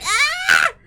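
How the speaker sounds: high mock squeal; cutesy squeal. Streamer squealing mid-reading in kawaii voice. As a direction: let out a cute little squeal. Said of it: "a super kawaii desu voice".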